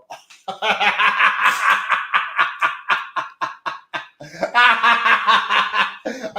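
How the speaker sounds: long rising burst of laughter; real laughter after the parody. Real, not acted. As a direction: crack up laughing at his own bit.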